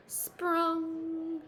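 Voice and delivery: sound effect word. A narrator's reading.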